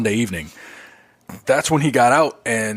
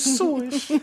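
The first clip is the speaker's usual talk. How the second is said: squeaky swish; 4 dB quieter than usual. Pretend. Echoing swish playfully.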